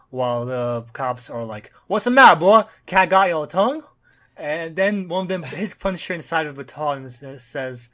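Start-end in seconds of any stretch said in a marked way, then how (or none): 1.9-3.8 loud, high Southern taunt; Southern cop accent. Acted. Cops taunting the pulled-over driver, loud Southern accent. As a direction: taunt him like a Southern lawman. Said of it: "you can see their southern accent".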